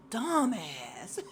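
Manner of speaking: loud, drawn-out mock insult; mock exasperation. Davis giving Tariq a look, mock exasperation. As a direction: stretch it out as an imitated silent jab. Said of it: "gives Tariq a look like".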